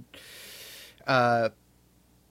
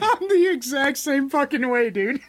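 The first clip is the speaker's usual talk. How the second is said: high, loud, laughing burst; excited agreement; 11 semitones higher, 5 dB louder than usual. Real, not acted. Co-host heartily agreeing about women's voices, excited. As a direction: agree loudly, pitch jumping right up.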